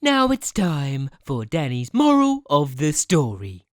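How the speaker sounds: slow, low, stretched-out title announcement; segment announcer. Pretend. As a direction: announce the moral like a show segment.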